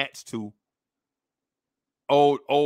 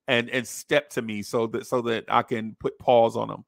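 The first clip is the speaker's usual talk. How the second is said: ordinary delivery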